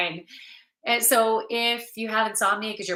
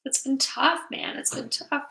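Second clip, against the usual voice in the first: tearful; 7 semitones higher than usual. Real, not acted. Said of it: "getting emotional".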